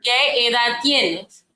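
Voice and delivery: slow, careful Spanish. Shown as a demonstration. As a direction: spell it out slowly for a learner. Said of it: "say that one more again".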